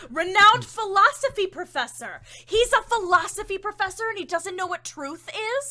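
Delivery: high, loud mocking scorn; sarcastic. Real, not acted. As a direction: sneer at the title. Mocking the professor's title.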